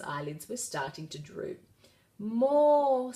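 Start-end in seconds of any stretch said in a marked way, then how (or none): 2.2-3.1 half-hearted. A narrator's reading. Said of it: "said the dragon half-heartedly".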